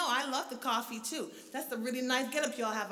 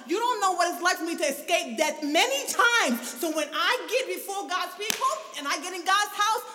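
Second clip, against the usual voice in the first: rising testimony, each line pressing harder; building preaching; 4 dB louder than usual. Acted. Pastor telling the church her past, building preaching. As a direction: lay out your past to the church.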